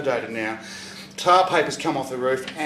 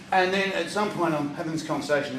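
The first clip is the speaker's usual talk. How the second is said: usual speaking voice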